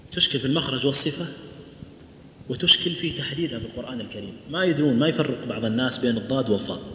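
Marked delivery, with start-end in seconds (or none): none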